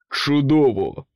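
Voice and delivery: loud gruff grumble; king grumbles. A narrator's reading. King grumbles at ambition's whisper, loud and gruff. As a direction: grunt it without interest. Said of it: "буркнув Влада".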